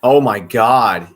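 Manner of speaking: loud, high disbelief at a bad play; real exasperated sports-fan outburst. Real, not acted. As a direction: cry out in dismay at the blunder.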